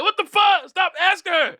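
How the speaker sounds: hammed-up outrage; mock outrage. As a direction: cut him off. Husband objecting.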